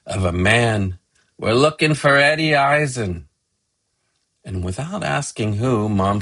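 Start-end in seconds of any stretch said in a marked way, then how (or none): 1.4-3.3 put-on higher-pitched man with a Russian accent; thick Russian accent. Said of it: "A Russian-sounding voice with a very thick accent".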